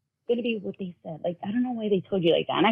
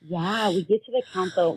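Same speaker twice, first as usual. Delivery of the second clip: ordinary talk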